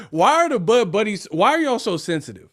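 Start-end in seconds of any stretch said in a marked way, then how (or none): none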